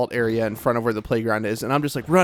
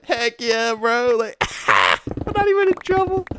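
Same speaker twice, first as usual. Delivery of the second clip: high gleeful cheer, laughing; 10 semitones higher, slower than usual. Retold or imitated. Kid cheering that dad is proud, high gleeful laugh. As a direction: crow with delight.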